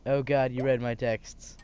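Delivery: usual speaking voice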